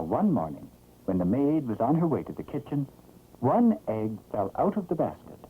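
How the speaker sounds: usual speaking voice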